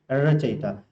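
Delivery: usual speaking voice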